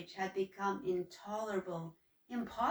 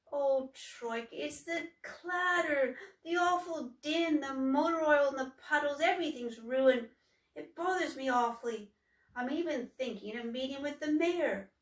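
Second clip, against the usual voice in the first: higher, weary complaint; troubled troll; 5 semitones higher, 4 dB louder than usual. Acted. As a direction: let it all spill out to your sister. Humboldt pours out his troubles.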